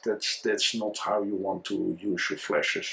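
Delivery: usual speaking voice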